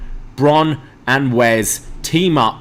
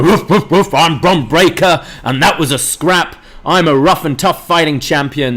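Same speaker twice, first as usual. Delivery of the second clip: higher, louder mock tough-guy barking; mocking Bron; 4 semitones higher, 3 dB louder than usual. Heel duo mocks champion Bron's talk, higher mock bark. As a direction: ham up a meathead champion. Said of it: "They're kind of mocking Bron, uh, how he talks".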